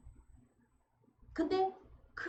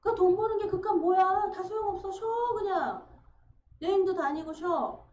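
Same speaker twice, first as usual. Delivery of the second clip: higher and louder, insisting; doting parent, emphatic; 6 semitones higher, 6 dB louder than usual. Retold or imitated. Parent telling a struggling child to rest, loud and emphatic. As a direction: wave away study like a doting parent.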